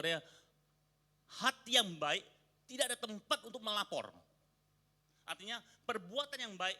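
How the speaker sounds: loud, high sermon delivery; fiery preaching. Acted. Pastor explaining the proverb to the congregation, fiery preaching. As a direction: preach it out to the whole room.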